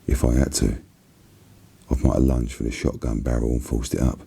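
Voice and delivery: ordinary delivery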